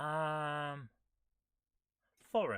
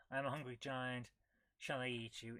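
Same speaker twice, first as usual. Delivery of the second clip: lightly voiced giant; 4 semitones lower, 8 dB quieter than usual. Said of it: "Giant voice says".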